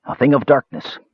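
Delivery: a touch of grim menace, falling; ominous mage. A narrator's reading. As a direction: lay it down like a dark warning. Mage warns of evil charm.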